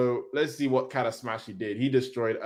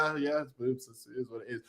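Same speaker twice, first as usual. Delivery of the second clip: bored shrug; 10 dB quieter than usual. Retold or imitated. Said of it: "you're like".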